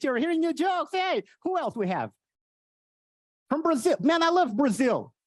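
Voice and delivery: ordinary talk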